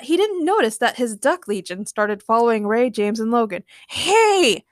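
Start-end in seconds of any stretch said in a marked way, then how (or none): 3.9-4.7 high, louder shout; sharp raised yell. A narrator's reading. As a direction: call after runaway ducks. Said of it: "Doug shouted at his ducks".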